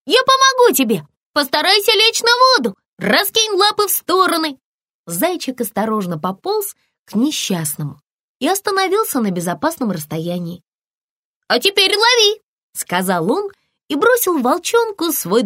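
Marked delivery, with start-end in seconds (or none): 0.1-4.6 высокие торопливые команды спасателя; выкрикивай указания быстро и высоко; крик зайчика. A narrator's reading. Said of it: "крикнул он". 11.5-12.4 высокий оклик зайчика; крикни повыше, бросая помощь; оклик спасателя. A narrator's reading. Зайчик спасает тонущего волчонка.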